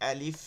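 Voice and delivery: "আলিফ" with a lengthened ending; teacher's model "আলিফ", final f held; drawn-out letter name. Shown as a demonstration. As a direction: recite "আলিফ" carefully, stretching the lif. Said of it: "এক আলিফ টেনে টেনে পড়বো".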